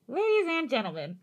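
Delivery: put-on old-time newsreel announcer voice; booming vintage radio announcer; 1930s news announcer. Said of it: "From nineteen thirties news announcer".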